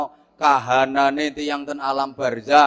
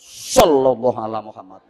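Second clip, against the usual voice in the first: mock pious card player, low with a loud burst; card-slamming salawat; 6 semitones lower, 5 dB louder than usual. Suggesting players recite salawat as they slam cards, mock devout exclamation. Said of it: "Ya banting karo maca selawat".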